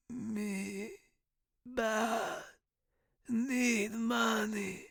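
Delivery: high, faint, slow rasp; hoarse whisper. A narrator's reading. Dying professor to student, hoarse whisper. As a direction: gasp the words out weakly. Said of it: "he whispered".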